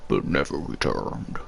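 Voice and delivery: low, slow, hollow meme voice; deep robot voice. Retold or imitated. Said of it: "deep robot voice".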